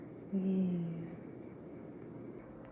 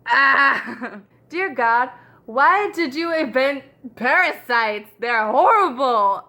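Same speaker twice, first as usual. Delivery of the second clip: louder and higher, slowed, in real revulsion; disgusted outcry; 5 semitones higher, 8 dB louder, slower than usual. Real, not acted. Vlogger rails at God about parasites, loud and revolted. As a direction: cry out to God in horror at parasites. Disgusted outburst over ticks.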